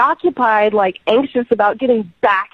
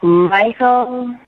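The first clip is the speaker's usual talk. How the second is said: sad sigh. Real, not acted. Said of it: "that's a pretty heavy sigh there".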